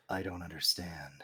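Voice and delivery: low dragged-out villain voice; Joker voice. Pretend. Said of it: "As the Joker".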